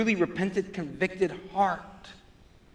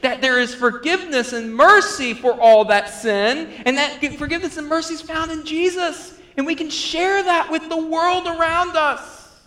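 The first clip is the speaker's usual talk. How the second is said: high, loud, building proclamation; impassioned pulpit crescendo; fervent preaching; 10 semitones higher, 8 dB louder than usual. Real, not acted. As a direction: proclaim the good news with soaring urgency. Preaches at full force.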